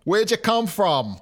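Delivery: put-on slow, drawn-out Boston accent. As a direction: lean into the Boston vowels. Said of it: "a thick Boston accent".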